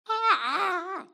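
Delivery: high wailing ah cries; screaming groans. Shown as a demonstration. Preacher showing how Peter might scream his remorse: high wailing cries. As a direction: let out cries of anguish at the top of your lungs. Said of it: "That's one way".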